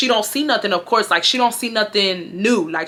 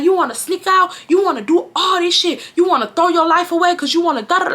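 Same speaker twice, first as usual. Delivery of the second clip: high-pitched exasperated mom imitation; lecturing mom; 9 semitones higher than usual. Mom dragging her child downstairs, lecturing. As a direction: imitate a fed-up mother. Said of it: "she's just giving me a lecture".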